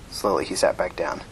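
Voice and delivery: usual speaking voice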